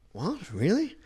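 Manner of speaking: high, rising questions; mock disbelief. Comic reacting to her put-down, high incredulous questions. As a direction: squeak it, taken aback. Acted disbelief at retold insult.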